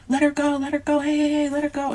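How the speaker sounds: put-on high pleading voice in a light Mexican accent; lightly imitated Mexican accent, begging him to let go; broken English. Mexican janitor ordering the ex to let her go, light accent. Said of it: "In her little broken English".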